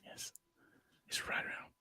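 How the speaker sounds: hushed golf broadcast whisper; golf commentator whisper. Pretend. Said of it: "I had to use my golf voice".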